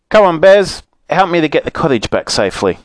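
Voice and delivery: high, bright call to the bears. Acted. Moonface calls the bears.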